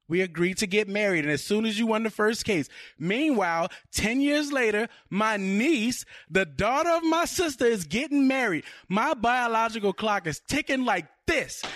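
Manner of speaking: high-pitched, mounting indignation; hammed-up, impatient bride-to-be; ranting movie fiancée. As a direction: build a fed-up girlfriend's complaint. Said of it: "I'm gonna go with Marissa Tomei's character".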